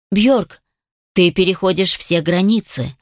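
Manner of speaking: plain reading voice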